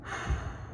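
soft, breath-only sigh; weary sigh. Real, not acted. Fan taking in his team's loss, weary sigh. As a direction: let out a dejected sigh.

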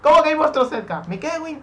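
high, loud indignant shouting; outraged yell. Real, not acted. Player told monsters are near, high outraged yell. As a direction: shout back in frantic disbelief.

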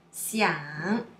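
xiang dipping low, then rising; third tone. Shown as a demonstration. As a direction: stretch the low dip in xiang. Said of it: "Let's see more example".

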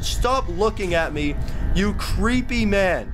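high-pitched, whiny exasperation; frustrated. Real, not acted. Player telling the creepy neighbor to get out, frustrated. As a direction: complain in a high, fed-up wail. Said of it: "I'm so frustrated".

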